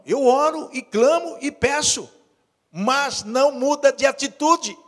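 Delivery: high, forceful pulpit exhortation; impassioned preaching. Real, not acted. Pastor challenging the congregation to change, impassioned preaching.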